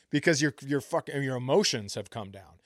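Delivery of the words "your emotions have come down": ordinary talk, nothing marked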